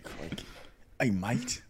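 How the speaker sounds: lightly put-on Aussie greeting; mock Australian accent. Riffing on Australians with friends, mock Australian accent. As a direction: toss it off in a fake Australian accent. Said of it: "Australian people's accent is just like country".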